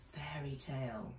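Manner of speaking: hushed chapter title; whispered. A narrator's reading. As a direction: breathe it out softly.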